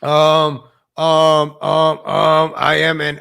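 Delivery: halting monotone full of drawn-out ums; mimicking her speech. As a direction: stall on every phrase with an exaggerated um, imitating her. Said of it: "let me read this in her language".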